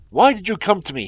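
loud, high, raving at him; exasperated cry. A narrator's reading. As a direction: rage at the delay. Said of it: "he cried".

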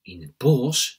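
loud cry of disbelief; exclaimed question. A narrator's reading. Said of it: "roepen drie, vier kinderen tegelijk".

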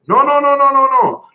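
a tiny cookie man shooing the dragon away; gingerbread man. Acted. Gingerbread man refusing the dragon, high and loud. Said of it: "gli risponde l'omino di Marzapane".

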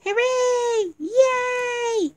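high, gleeful shout of joy; excited cheer. Real, not acted. Kids cheering that story time is coming, high excited shout.

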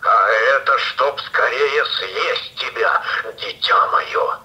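menacing wolf. Acted. Wolf reveals itself.

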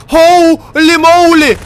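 loud, high, drawn-out amazement; astonished shout. Real, not acted. Player sees a new monster, astonished shout.

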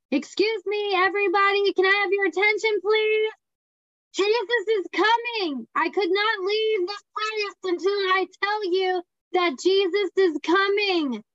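high, slow public announcement; calling out to a whole restaurant. Retold or imitated. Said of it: "had me stand in a restaurant today and yell".

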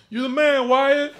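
high-pitched hype-man holler; demo yell. Shown as a demonstration. Comic tells an audience member what to yell out, high demo yell. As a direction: yell it out like an excited fan. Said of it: "I just want you to yell out".

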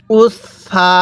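উস সা, long held s across both syllables; doubled consonant. Shown as a demonstration. Teacher leading learners to read along, doubled s held long. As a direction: hold the s from উস into সা. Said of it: "আমি যেভাবে পড়ব আপনিও সেভাবে".

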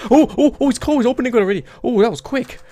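loud, high startled yelps; startled panic. Real, not acted. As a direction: yelp in sudden alarm. Panic as hatch opens.

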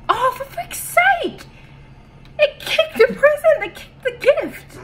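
high, loud frustrated cry; real frustrated scream; exasperated shriek. Real, not acted. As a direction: shriek in disbelief. Enemy kicked the gift.

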